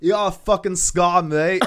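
high put-on London accent, teasing; lightly hammed cockney mate; mock London twin. Twins tease Harry, high mock London accent.

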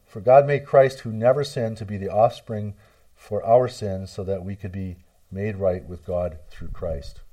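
ordinary talk

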